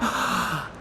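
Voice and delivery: sharp soft gasp; startled breathy gasp. Acted. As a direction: gasp in sudden realisation. Imp realising trick.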